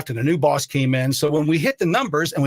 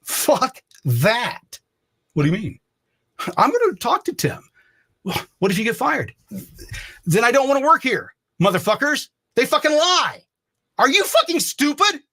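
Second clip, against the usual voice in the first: heated retold outburst; 8 semitones higher than usual. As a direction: blow up at the timid coworkers. Retold heated argument with coworkers.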